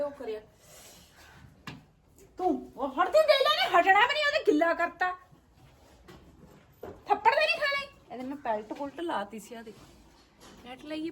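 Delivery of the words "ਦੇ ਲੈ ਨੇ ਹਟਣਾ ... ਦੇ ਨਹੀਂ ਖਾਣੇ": scolding. Real, not acted. Scolding the calf loudly.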